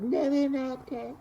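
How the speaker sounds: high, drawn-out mocking whine. Imitating hecklers the speaker scorns, mocking voice. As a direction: stretch it out high in a put-on jeering voice.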